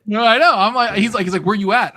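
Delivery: high, loud mock-needy friend; excited loud retelling. Telling how the friend kept asking where he was, loud and high. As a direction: whine it like the friend who keeps calling.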